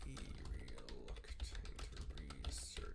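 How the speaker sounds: drawn-out low whisper of the title; hushed, eerie whisper, very slow; slow spooky whisper. Shown as a demonstration. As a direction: breathe the title out slowly like a ghost story. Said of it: "repeated as a spooky whisper".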